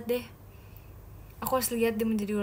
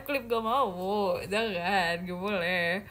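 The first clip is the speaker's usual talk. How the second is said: ordinary talk, nothing marked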